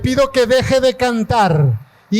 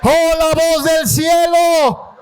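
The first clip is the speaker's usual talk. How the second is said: shouted, higher-pitched preaching; preaching at full force; 5 semitones higher, slower than usual. Real, not acted. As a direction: preach it out to the crowd. (predicación a todo pulmón, gritando)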